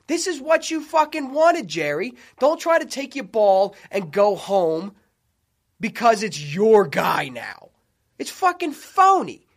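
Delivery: lets his anger at Jerry boil over; heated rant. Real, not acted. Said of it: "I'm getting heated".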